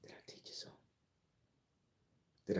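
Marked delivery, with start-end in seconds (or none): 0.0-0.8 whisper. Acted. Teasing question to viewers.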